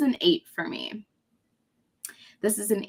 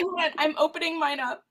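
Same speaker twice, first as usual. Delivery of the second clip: usual speaking voice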